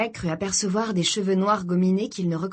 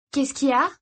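put-on higher-pitched young girl's question; lightly voiced girl character; curious girl; 6 semitones higher, 3 dB louder than usual. A narrator's reading. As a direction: ask it high and lightly, as the sister. Girl asks her brother.

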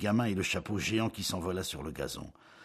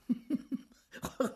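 laughing. A narrator's reading. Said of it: "il éclata".

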